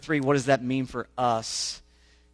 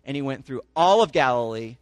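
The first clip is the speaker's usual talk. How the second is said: rising, emphatic public reading of the passage; scripture reading; 3 semitones higher, 5 dB louder than usual. Acted. Pastor reading Mark to his congregation, animated scripture reading. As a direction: read the gospel out to the congregation with growing force.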